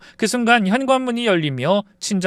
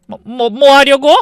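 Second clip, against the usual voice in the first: panicked stammer; 11 semitones higher, 10 dB louder than usual. Acted. In-laws panic over creditors.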